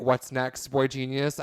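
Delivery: ordinary talk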